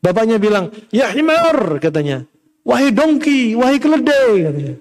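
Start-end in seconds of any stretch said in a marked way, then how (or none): 0.9-1.8 mock angry name-calling, pitched up; angry father. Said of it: "bapak marah sama anak". 2.6-4.3 mock scolding, pitched up; angry father. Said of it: "bapak marah sama anak".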